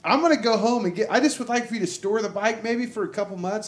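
high, loud pleading; pleading rider. Retold or imitated. Rider asking the dealership owner to store his bike, pleading high. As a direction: beg him to store the bike.

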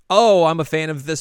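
high, loud fan gushing; acted fan enthusiasm. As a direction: gush like an eager fan. Reading fans' notes in character.

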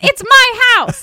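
slow, high-pitched hammed-up protest; mock squeal. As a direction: squeal it like an offended homeowner. Retelling TV scene, acted quote.

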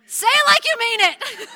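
high, loud, half-laughing demand; exasperated parent. Retold or imitated. Parent demanding a sincere apology, loud and high with a laugh. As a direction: push it up like a parent at the end of their rope. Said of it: "And then we're like".

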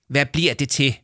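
irritated, needling question; snarled. A narrator's reading. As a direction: demand an answer testily. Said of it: "vridsede Leo Manchot".

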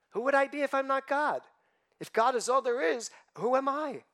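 high, pleading self-doubt voice; questioning worshipper. Retold or imitated. Worshipper voiced asking who they are, in high pleading tones.

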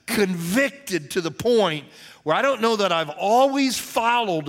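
loud, high, drawn-out sermon climax; impassioned preaching. Real, not acted. Pastor vowing to follow his Father, impassioned preaching. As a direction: preach it at full force, stretching the key words.

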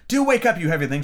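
high, loud impatient call; Alice trying to wake the sleeping queens, impatient. A narrator's reading. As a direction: urge the sleeper awake, fed up. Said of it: "in an impatient tone".

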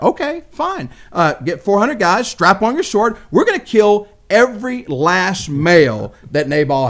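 rally the men for revenge, seething; angry overreaction. Retold or imitated. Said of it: "Talking about overreaction".

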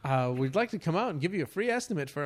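upbeat caller offering a free estimate; sales caller. Retold or imitated.

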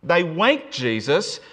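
ordinary talk, nothing marked

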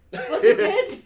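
laughing through it, rising high and loud. Retold or imitated. Host reveals the prank to couples, laughing. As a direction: crack up on the punchline.

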